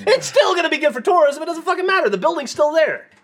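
high, loud exasperated protest; arguing with a friend about the Queen, high and exasperated; exasperated outburst. Real, not acted. Heated argument about monarchy.

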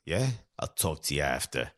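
very low, soft New York voice; New York accent. Retold or imitated. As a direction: drop deep and quiet into a New York mother impression. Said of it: "Does she sound like this?".

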